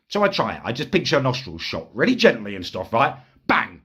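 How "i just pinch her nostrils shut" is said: ordinary delivery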